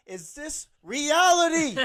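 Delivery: loud, high, slow dazed question; hammed-up shock; stunned disbelief. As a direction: ask the room if this is real. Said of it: "I'm like".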